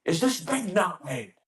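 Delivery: high, drawn-out mock yell; angry drill instructor impression; imitated instructor's scream. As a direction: slowly holler it like an angry instructor. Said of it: "screamed at you for your answer".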